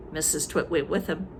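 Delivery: usual speaking voice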